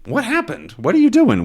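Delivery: high-pitched bewildered dog voice; Snowy. Acted. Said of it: "Snowy, of course, is going".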